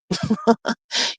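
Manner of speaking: soft, short chuckle; quiet real laugh. Real, not acted. Streamer wishing Miss Denise better, soft chuckle. Laughs while greeting a viewer.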